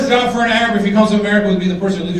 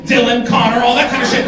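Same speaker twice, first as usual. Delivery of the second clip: usual speaking voice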